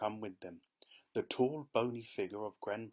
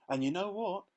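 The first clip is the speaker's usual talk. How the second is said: higher, louder gossipy call; another crowd shout; 9 semitones higher, 4 dB louder than usual. A narrator's reading. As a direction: shout back to a neighbor. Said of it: "someone else shouted back".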